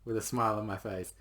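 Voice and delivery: usual speaking voice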